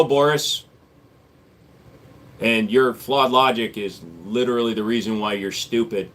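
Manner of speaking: normal talking voice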